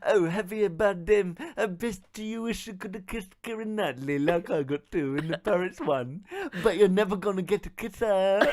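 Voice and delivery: lightly voiced taunting film star; Johnny Depp voice. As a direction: tease the audience about Keira. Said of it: "my Johnny Depp accent".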